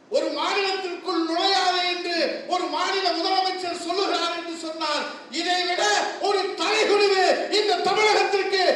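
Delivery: rising from firm to a high, loud shout; oratory building to a shout. Acted. Speaker rousing the party crowd against the insult. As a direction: build the outrage line by line.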